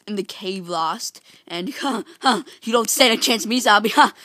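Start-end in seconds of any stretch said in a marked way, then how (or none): 1.8-4.1 shouted mock-tough challenge; loud taunt. Player to a zombie in a fight, loud taunting. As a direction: square up to the enemy at full volume.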